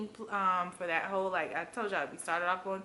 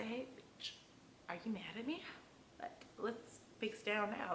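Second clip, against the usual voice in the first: hushed, gentle confrontation; soft aside; 2 semitones higher, 12 dB quieter than usual. Retold or imitated. Coworker pulled aside and asked gently, hushed. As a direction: soften right down like pulling someone aside. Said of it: "just pull me aside and say".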